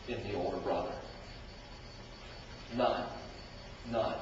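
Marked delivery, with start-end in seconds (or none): none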